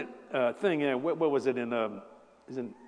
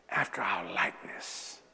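low slow whisper; 7 semitones lower, 7 dB quieter than usual. Acted. Preacher whispering God's words to the congregation, reverent whisper.

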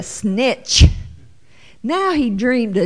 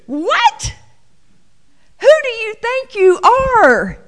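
much higher, indignant challenge; outraged disbelief; 12 semitones higher, 4 dB louder, slower than usual. Pretend. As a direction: demand he know his place. Brothers outraged at the dream.

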